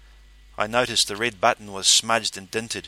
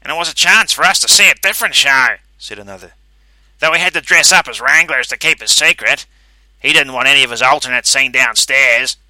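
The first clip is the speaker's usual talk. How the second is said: raised character voice; 4 semitones higher, 12 dB louder than usual. A narrator's reading. Another Calverley explains.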